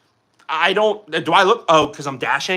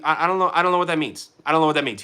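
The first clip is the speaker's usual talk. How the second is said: ordinary delivery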